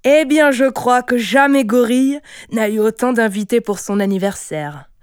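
loud, high start, easing down; hilarious amusement. A narrator's reading. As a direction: exclaim in great amusement. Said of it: "Richard éclata de rire". (exclamation très amusée)

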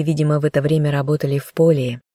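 plain reading voice

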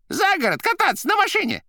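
high, loud rattling cry; shouting. A narrator's reading. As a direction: shout it down the line. Koroviev on phone.

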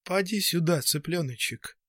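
timid, coaxing call to a chick; hesitant coaxing. A narrator's reading. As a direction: coax gently, unsure of yourself. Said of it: "нерешительно произнесла".

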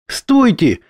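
high urgent call; exclaiming. A narrator's reading. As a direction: call out to stop them. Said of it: "воскликнула она".

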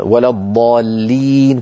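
ولا الضالين recited slowly; slow recitation. Shown as a demonstration. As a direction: draw out the long vowels of ولا الضالين. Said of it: "مثلًا". (تلاوة متأنية)

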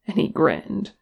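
ordinary delivery, nothing marked

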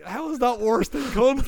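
high, slowed mock disbelief; baffled. Kane Hodder arguing gee bag is milder, high baffled. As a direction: demand it, genuinely baffled. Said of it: "He's like".

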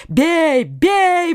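high cry, climbing on the repeat; yelling mob. A narrator's reading. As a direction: urge the attack like a crowd. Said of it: "вопили со всех сторон".

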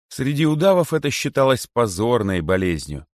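plain reading voice

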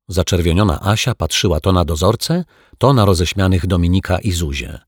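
ordinary delivery

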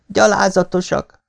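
high-pitched, mildly stifled reproach; choked indignation. A narrator's reading. Daughter reproaching the mob beside her father, choked. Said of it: "mondta elfulladva".

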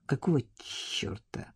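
whispered to himself; inner thought whisper. A narrator's reading. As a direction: think it under the breath. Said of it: "подумал Арсений". (шёпотом, про себя)

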